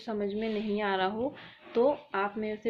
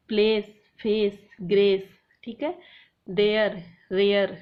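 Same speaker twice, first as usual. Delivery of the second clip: Place, फेस, grace louder, endings एस and एयर stressed; loud rhyme callout; 7 dB louder than usual. Shown as a demonstration. Teacher to students, stressing matching endings. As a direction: call the rhyming endings out strongly. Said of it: "तो ये same है".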